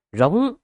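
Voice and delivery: a strangled roar forced high from the throat. Acted. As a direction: choke out a furious howl. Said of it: "chỉ có thể rít gào từ cổ họng".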